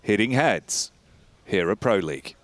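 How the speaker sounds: ordinary talk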